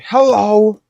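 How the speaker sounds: high, loud cheery greeting; pollen saying hello. Pretend. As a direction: greet them like an eager villain. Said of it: "the pollen was like".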